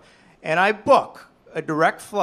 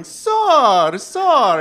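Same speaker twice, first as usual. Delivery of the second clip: put-on loud, high, formal old-world official calling out; Ellis Island official; 8 semitones higher, 10 dB louder than usual. Comedian imitating an Ellis Island official calling sir. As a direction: call him like a stern immigration officer. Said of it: "Mister Ellis Island shows up my head going".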